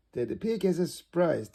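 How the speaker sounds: ordinary delivery, nothing marked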